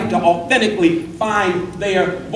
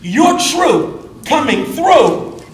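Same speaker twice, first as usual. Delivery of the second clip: rising preaching fervor; 7 semitones higher, 4 dB louder, slower than usual. Acted. Preaching climax on truth.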